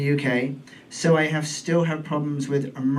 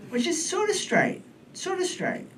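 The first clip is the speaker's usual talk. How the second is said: unsure hedge; 13 semitones higher, 4 dB quieter than usual. Acted. Hedging, rising high and trailing.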